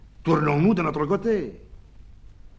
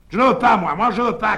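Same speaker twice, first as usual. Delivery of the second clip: loud, high refusal, both arms raised; crying out; 8 semitones higher, 9 dB louder, faster than usual. A narrator's reading. Louison refusing the doctor, loud high cry. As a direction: shout it out, higher and louder. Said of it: "cria Louison Coquelicot".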